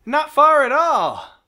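high, loud, drawn-out cheer; relieved exclamation. Real, not acted. Player answers how far back they went, relieved exclamation. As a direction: belt it out slowly in relief. Relieved at the save point found.

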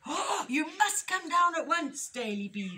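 gasp, then a high, loud urgent cry; alarmed rabbits calling up a tree; panicked cry. A narrator's reading. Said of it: "they cried".

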